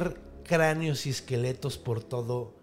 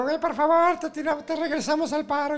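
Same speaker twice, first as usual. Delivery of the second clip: pleading bees; 12 semitones higher than usual. Acted. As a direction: stretch it out like a swarm of tiny bees.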